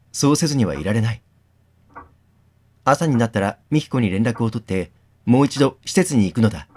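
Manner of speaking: steady reading of the inner monologue; story narration. A narrator's reading. Streamer reading a visual novel aloud to viewers, narrating. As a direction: read the game text like a novel.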